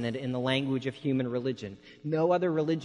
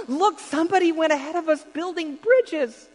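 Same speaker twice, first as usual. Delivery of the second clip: much higher, louder, bursting with joy; excited little girl; 6 dB louder than usual. Retold or imitated. Little girl on the train excitedly telling her parents about the bridges. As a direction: squeal it like a thrilled child.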